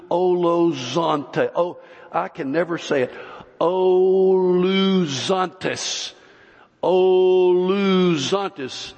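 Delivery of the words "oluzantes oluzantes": Oluzantes stretched slow and wailing; drawn-out Greek word. Shown as a demonstration. Preacher sounding out the Greek word slowly. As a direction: draw out Oluzantes like a long wail. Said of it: "I'm wailing".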